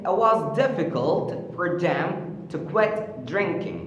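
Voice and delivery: normal talking voice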